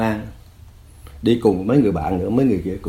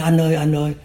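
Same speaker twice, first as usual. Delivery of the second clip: higher-pitched imitation of tearful begging; tearful pleading; 6 semitones higher than usual. Exposed former guard begging his old prisoner, tearful pleading. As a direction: plead urgently, only lightly mimicking tears. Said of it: "nó khóc".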